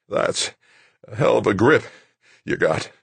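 strained grunt in broken phrases; halting words with pauses between. A narrator's reading. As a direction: force it out under strain. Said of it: "Gordet grunted".

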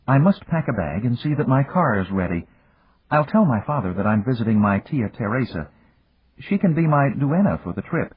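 lightly voiced young lady, pitched high; a hint of an excited heroine; excited young woman. A narrator's reading. Narrator voices excited Lucita.

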